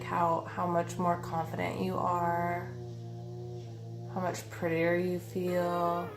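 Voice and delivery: ordinary talk, nothing marked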